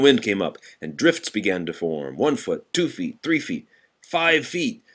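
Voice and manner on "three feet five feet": usual speaking voice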